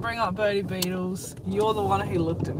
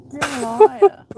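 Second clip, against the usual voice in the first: higher, louder playful accusation; mock-outraged shout; 7 semitones higher, 11 dB louder, slower than usual. Real, not acted. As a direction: call out the lie loudly. Friend calls out prank.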